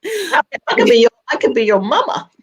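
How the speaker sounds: teasing joke. Real, not acted. Joking reply about age.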